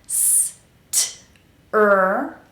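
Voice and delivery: "S, t, er" as isolated sounds, one at a time; slow separate consonants. Shown as a demonstration. Coach breaks down strict, slow isolated sounds. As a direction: sound out "S, t, er" slowly with gaps. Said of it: "pronounce every consonant slowly".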